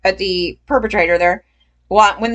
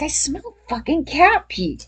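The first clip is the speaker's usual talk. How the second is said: disgusted mutter; 6 semitones higher, 5 dB quieter than usual. Retold or imitated. Disgusted at the smelly jacket.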